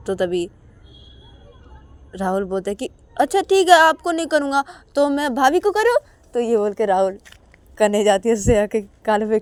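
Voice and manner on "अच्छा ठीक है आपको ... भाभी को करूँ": high, loud cheeky little-brother voice; little brother teasing his sister; cheeky younger brother. Acted. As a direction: tease her back playfully. Rahul teases back.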